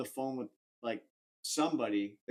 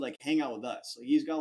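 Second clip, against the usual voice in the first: normal talking voice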